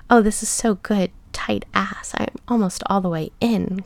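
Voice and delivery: usual speaking voice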